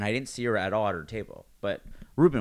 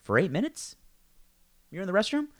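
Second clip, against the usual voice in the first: high disbelieving questions; incredulous; 10 semitones higher than usual. Retold or imitated. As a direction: ask it in mock disbelief, pitched up. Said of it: "I'm like".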